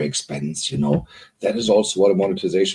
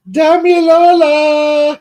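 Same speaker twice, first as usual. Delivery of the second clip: stretched-out name, much higher and louder; long joyful call-out; 10 dB louder, much slower than usual. Real, not acted. Host spotting a friend in the chat, calls her name out loud and long. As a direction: call her name across the room, drawn out.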